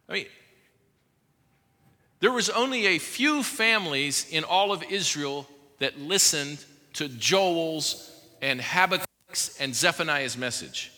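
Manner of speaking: usual speaking voice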